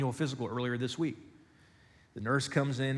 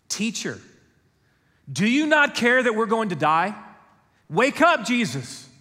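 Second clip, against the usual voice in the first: high, loud, desperate cry to a sleeping Jesus; frantic disciples shouting over the storm; panicked plea; 6 semitones higher, 6 dB louder than usual. Acted. Disciples in the sinking boat beg Jesus, panicked and loud.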